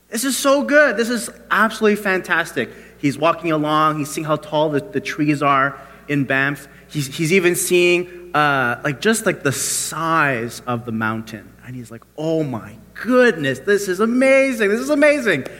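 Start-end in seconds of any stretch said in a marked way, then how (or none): 0.1-2.7 high-pitched gush of wonder; acted-out amazement, pitched up; delighted awe. Atheist marvels at the scenery. 12.2-15.5 climbing to high, gushing amazement; hammed-up awe, rising higher as it repeats; awestruck exclamation. Atheist awestruck by mountain size.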